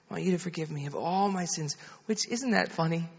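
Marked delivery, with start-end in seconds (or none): 0.1-1.8 quicker, softer imitation of a child repeating; retold child's soft repeat; child echoing prayer. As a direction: echo the prayer line quietly and briskly. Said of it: "You just pray after me".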